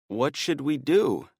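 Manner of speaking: strained, cracking, slow question. A narrator's reading. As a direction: voice breaking on last word. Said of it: "his voice cracking on the last word".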